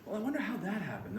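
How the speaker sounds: high-pitched musing, put-on detective voice; bumbling Columbo. Detective just asking questions, high mock puzzlement. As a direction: play innocently puzzled. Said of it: "he would just ask questions".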